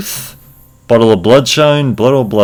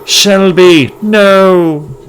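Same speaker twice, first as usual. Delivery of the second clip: anguished cry; 8 semitones higher, 3 dB louder, much slower than usual. Acted. Character falls in battle.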